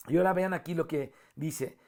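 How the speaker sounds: ordinary delivery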